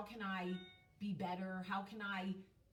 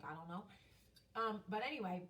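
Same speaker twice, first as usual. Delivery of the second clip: usual speaking voice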